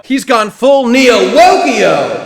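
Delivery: slow, loud, drawn-out shout of the pun; mocking shout. Real, not acted. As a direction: bellow the punchline slowly and loudly. Mocking shout about character.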